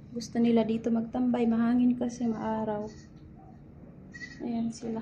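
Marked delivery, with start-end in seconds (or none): none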